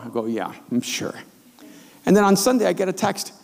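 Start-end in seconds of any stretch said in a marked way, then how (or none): 0.2-1.1 sarcastic disbelief. Retold or imitated. Doubting Lewis's excuse.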